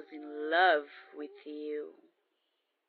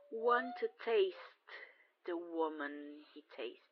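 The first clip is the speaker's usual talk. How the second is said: ordinary delivery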